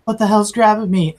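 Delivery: startled, panicky thug voice; alarmed thug. Acted. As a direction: cry out like a thug grabbed in the dark. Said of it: "you hear the thugs go".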